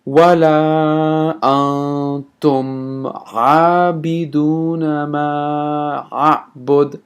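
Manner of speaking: very slow, drawn-out chanted recitation; teacher recites the verse for the class. Shown as a demonstration. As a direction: stretch every vowel like a recitation. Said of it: "let's say this verse".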